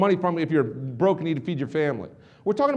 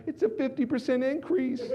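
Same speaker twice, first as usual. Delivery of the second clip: high, soft mock wail; crying; 9 semitones higher, 5 dB quieter than usual. Wife crying over the pay, mock tearful. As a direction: imitate tearful protest in a raised, quiet voice. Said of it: "while she's crying".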